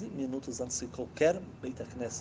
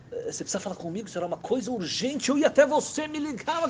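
rising, higher and louder astonishment; flustered surprise; 10 semitones higher, 3 dB louder than usual. Acted. The husband greeting the great rabbi at dawn, flustered. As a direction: caught off guard at the door.

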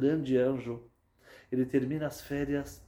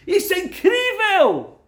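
astonished cry; 13 dB louder, slower than usual. Acted. Doctor repeats it in astonishment.

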